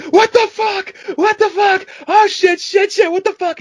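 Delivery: very high, loud panicked scream; shrieked, frantic cursing from a night terror; mad screaming. Retold or imitated. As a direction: imitate waking up screaming madly. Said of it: "just screaming madly".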